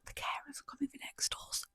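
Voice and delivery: barely audible whisper; hushed, voiceless muttering to a co-host; whispered aside. Real, not acted. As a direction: drop to a secretive whisper. Aside, off the recording's business.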